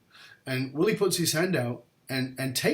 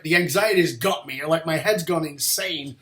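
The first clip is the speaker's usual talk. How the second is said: ordinary talk, nothing marked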